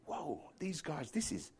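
fading to a breathless whisper as he creeps out; hushed whisper. Retold or imitated. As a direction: whisper so as not to disturb anyone. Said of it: "so I crept out".

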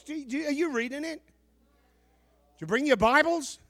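ordinary delivery

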